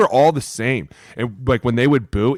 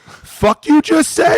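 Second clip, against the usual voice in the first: much higher, loud, slow confrontational snap; football mode; 8 dB louder, much slower than usual. Retold or imitated. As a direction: square up and challenge him. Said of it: "back in football mode".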